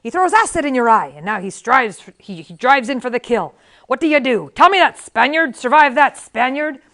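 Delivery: loud, driving sword-master challenge; MacPherson. A narrator's reading. Sword master MacPherson challenges his Spaniard pupil, loud and forceful.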